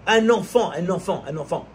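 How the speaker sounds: from forceful to hushed on each "un enfant"; fading repetition. Acted. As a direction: let "un enfant" die away over three repeats. Sermon, repeated words fade.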